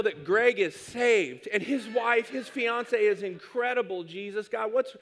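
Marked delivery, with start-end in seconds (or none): none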